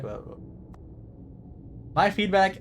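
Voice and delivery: usual speaking voice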